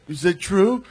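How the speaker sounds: high, timid cop. Retold or imitated. Cop voiced in retold video.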